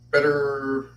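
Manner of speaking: ordinary talk, nothing marked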